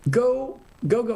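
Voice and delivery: fired-up call to action; urgent rallying cry. Real, not acted. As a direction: push them out the door to act. Urging listeners to go help.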